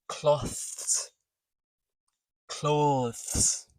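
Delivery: drawn-out "cloth, cloths, clothes", th held at the end; exaggerated final TH. Shown as a demonstration. Teacher drilling word-end TH, exaggerated articulation. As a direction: stretch each word into a long final th. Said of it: "That's how you would say it when it's exaggerated".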